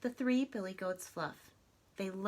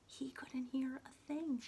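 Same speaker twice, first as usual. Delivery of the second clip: whispered; 5 semitones higher, 6 dB quieter than usual. A narrator's reading. Troll listening.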